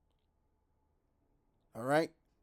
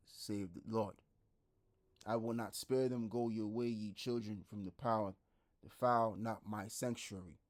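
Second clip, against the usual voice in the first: scripture reading; 4 semitones lower, 4 dB quieter than usual. Acted. Reads Ezra passage aloud.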